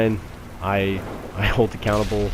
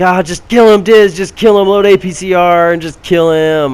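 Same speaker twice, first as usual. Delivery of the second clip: frustrated yelling; 9 semitones higher, 8 dB louder than usual. Retold or imitated. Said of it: "Oh, it's like".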